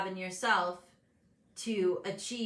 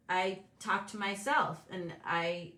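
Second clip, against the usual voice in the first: ordinary delivery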